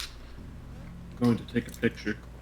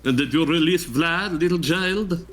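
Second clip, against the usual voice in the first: vampire voice; 5 semitones higher than usual. Pretend. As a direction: ham up a Count Dracula voice. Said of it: "give me your best vampire impression".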